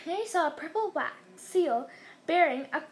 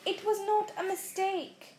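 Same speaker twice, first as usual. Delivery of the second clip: slow, higher, insistent protest; angry; 6 semitones higher, slower than usual. A narrator's reading. Boy contradicting uncle about letter, angry. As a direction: contradict angrily, spacing the words. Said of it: "said Harry angrily".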